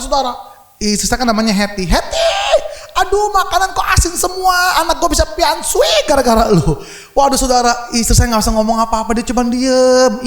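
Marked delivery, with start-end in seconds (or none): 1.9-6.7 high, shrill-pitched scolding mother voice; mother-in-law scolding. Retold or imitated. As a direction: play the mother-in-law snapping at her daughter-in-law. Mother-in-law scolding wife, retold. (ibu mertua memarahi menantu dengan suara tinggi)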